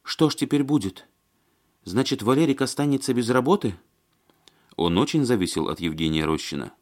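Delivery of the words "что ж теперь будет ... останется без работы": high-voiced, worried woman character; Маня. A narrator's reading. Woman worrying Валерик will lose work, anxious. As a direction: fret in a higher voice.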